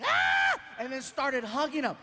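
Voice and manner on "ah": high, loud mock fangirl scream; imitated scream. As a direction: shriek like an excited fan. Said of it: "over to Martin screaming".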